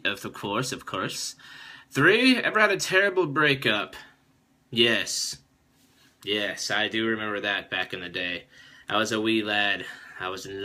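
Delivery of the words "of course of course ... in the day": ordinary delivery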